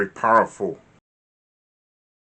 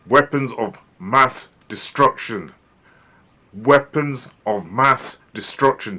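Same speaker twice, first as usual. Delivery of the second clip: slow, louder, each word weighted; ominous emphasis; 4 dB louder than usual. Shown as a demonstration. Teacher echoes the news phrase to evoke fear. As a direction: lean hard on every word. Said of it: "how does it make you feel".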